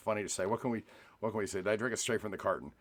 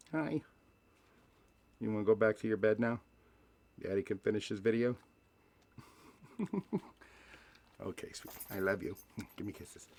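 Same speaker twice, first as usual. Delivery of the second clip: tender pet talk. Real, not acted. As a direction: coax the dog back to bed lovingly. Talking to his dog.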